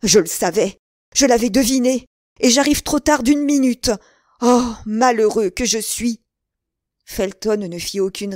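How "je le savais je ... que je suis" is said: loud cry climbing high, then easing; distraught exclamation. A narrator's reading. De Winter seizes Felton, crying out in distress. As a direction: cry out in self-reproach. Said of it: "lui sauta au cou en s'écriant".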